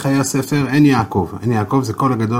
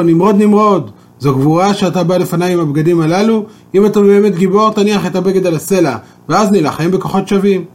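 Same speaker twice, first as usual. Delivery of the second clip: high, loud taunting call; defiant challenge; 5 semitones higher, 4 dB louder than usual. Acted. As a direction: call him out and dare him. Esau challenges Nimrod to duel.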